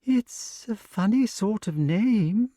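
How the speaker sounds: high, slow, doubting remark; doubtful snake. A narrator's reading. Snake judges Wart's name, high and doubtful. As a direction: let the doubt stretch the words. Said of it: "said the snake doubtfully".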